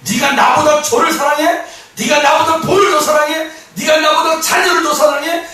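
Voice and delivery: loud, very high, jealous interrogation; acted jealous outburst, pitched high; jealous demand. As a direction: fire off accusing questions as a jealous lover. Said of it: "질투의 그 화신이 되어서".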